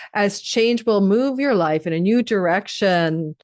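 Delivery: normal talking voice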